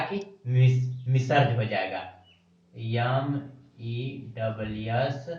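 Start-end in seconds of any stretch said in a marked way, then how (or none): 2.7-5.3 letters spelled slowly and softly; slow spelling out. Shown as a demonstration. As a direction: spell out M-E double S for the class. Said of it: "एम ई हो जाएगा". (धीरे से अक्षर बोलना)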